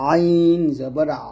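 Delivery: "আইন জবর আ" drawn out with a squeezed আ; pressed mid-throat ayn. Shown as a demonstration. Teacher repeating the ayn for learners, "আইন জবর আ" slow and pressed. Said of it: "একটু চাপ দিয়ে বলতে হবে".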